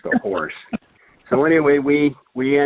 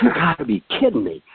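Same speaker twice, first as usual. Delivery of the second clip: higher, exclaimed in disbelief; excited disbelief; 7 semitones higher, faster than usual. Retold or imitated. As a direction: blurt it out high, amazed. Stunned by the same fish.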